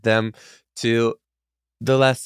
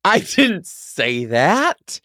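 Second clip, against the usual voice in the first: slow, high-pitched protest; indignant denial; 9 semitones higher, 3 dB louder, much slower than usual. Real, not acted. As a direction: deny it, drawn out and high. Son denies mother's accusation.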